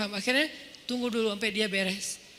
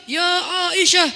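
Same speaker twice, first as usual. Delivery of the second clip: loud, high, booming call from the pulpit; pulpit shout; 6 semitones higher, 8 dB louder than usual. Shown as a demonstration. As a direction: bellow the name like an angry preacher. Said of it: "Pas di atas mimbar". (teriakan keras khatib di mimbar)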